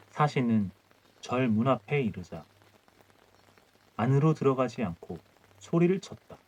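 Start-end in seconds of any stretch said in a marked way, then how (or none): none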